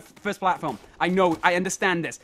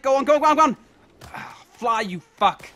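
ordinary delivery

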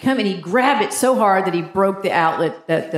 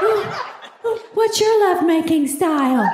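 breathy-sounding higher teasing coo; mocking a Cosmo quiz, much higher; 8 semitones higher than usual. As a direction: coo it like a cheesy magazine quiz. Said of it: "those little sex quizzes".

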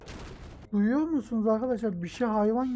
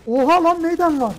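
loud, higher-pitched burst of surprise; startled shout; 8 semitones higher, 10 dB louder than usual. Real, not acted. Player startled by a threat in the game, shouting. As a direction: shout out in sudden alarm.

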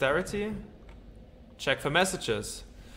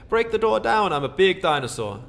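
louder, higher eager command; excited shout; 5 semitones higher, 5 dB louder than usual. Pretend. Dinosaur gamer rallying to smash the door, loud shout. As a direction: charge at the door.

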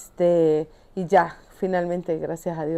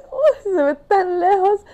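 high-pitched, slow and drawn out; daunted exclamation; 9 semitones higher, 3 dB louder, slower than usual. Retold or imitated. Climber seeing how far the pyramids are, high daunted exclamation. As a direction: stretch it out as if daunted. Reliving sight of distant pyramids.

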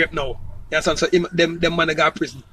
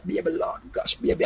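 whispering; 10 semitones higher, 10 dB quieter than usual. Retold or imitated. Intimate lover talk in bed.

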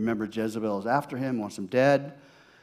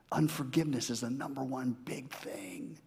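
unvoiced, softer whisper; 6 dB quieter than usual. Real, not acted. Pastor warning the congregation, whispered. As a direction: confide it to the room. Confiding aside.

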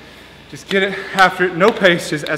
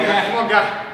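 ordinary talk, nothing marked

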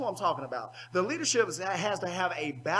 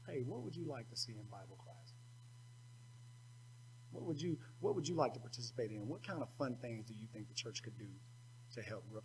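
put-on much softer, kindly questions to a young person; soft gentle asking; 8 semitones lower, 16 dB quieter, faster than usual. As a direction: ask gently, almost hushed. Imagined asking the youth.

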